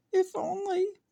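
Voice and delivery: very high, squeaky little mouse; a wistful little mouse, squeaking high; tiny mouse voice. Acted. Mouse voice in story.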